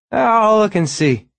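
put-on high, loud drunk voice; drunk man voice. A narrator's reading. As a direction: sing it out tipsy. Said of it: "The man was obviously drunk".

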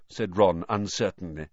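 usual speaking voice

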